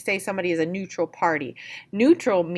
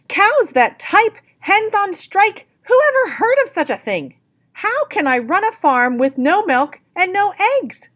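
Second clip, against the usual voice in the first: furious farmer; 13 semitones higher, 7 dB louder than usual. Acted. Furious farmer voiced in story.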